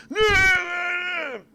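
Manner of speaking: very high, loud, drawn-out garbled wail; hammed-up shrieking bleat on one held note; third daughter's wail. Third daughter in a joke answering her mother, absurd loud wail. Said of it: "the third daughter comes up".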